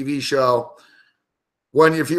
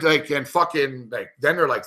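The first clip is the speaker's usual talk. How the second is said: ordinary talk, nothing marked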